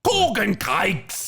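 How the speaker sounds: "Corgan cakes" drawn out slowly in a hammed-up British accent; mock British accent on "Corgan cakes". Shown as a demonstration. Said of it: "say it in her British accent".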